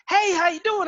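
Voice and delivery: high, loud Texas greeting; Texas twang impression. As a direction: holler hello like a Texan on the phone. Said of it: "he was like".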